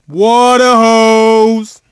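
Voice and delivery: long, stretched-out hype call; drawn-out cheer. Acted. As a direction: stretch out the shout slowly. Trash talk after a made shot.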